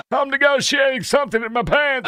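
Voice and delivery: a hammed-up Southern ex-president shout; Bill Clinton impression. Mimics Clinton over the clip.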